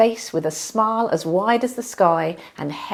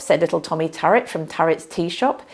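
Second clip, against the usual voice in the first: usual speaking voice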